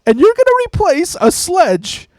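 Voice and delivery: very high, tight, throat closing with anger; like a huge wrestler squeaking with fury; high strangled rage. Retold or imitated. Imitating his boss scolding him, shrill strangled anger. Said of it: "his throat would close up with rage".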